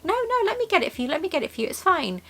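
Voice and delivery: high and quick, then softening to hushed; gently insisting. Retold or imitated. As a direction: brush off his protest kindly. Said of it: "I was like".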